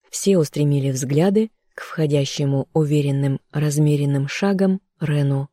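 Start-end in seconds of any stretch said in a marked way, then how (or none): none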